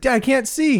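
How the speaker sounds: high-pitched mock whine-yell; imitated screaming complaint. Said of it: "He's, like, screaming at this guy".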